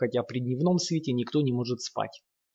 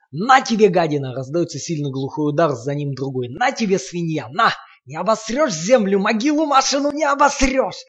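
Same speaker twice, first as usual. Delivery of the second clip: vicious shouting with blows; 5 semitones higher, 5 dB louder than usual. A narrator's reading. Lomov striking Sasha.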